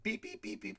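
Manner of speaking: imitated phone keypad beeps. Dog dialing the shelter, mock keypad beeps.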